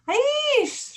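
very high, loud shriek; piercing little bird scream; panicked shriek. A narrator's reading. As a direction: let out a drawn-out high scream. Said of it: "shrieked Flap".

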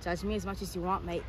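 soft put-on London accent. Answering a friend who judges her, soft London accent. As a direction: brush it off quietly in a London accent. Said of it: "I got a London accent now".

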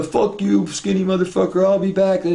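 high, mock furious shouting; angry parting threat. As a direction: mimic a thrown-out customer yelling threats. Retold parting threat of ejected customer.

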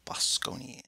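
hushed whisper of the name. Real, not acted. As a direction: whisper it to yourself. Tries the name, then an alternative.

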